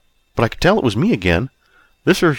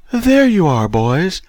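high, louder, swooping seductress voice; husky, enticing woman; 7 semitones higher, 3 dB louder, slower than usual.

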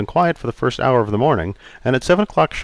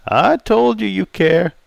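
put-on raised, jovial old customer; 10 semitones higher, slower than usual. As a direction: play Charlie, teasing.

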